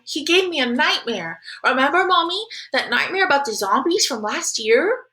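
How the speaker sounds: put-on high-pitched little kid telling mommy; excitable little brother. Little Jamie tells mommy about his nightmare, excitable. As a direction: voice an eager, wide-eyed small boy.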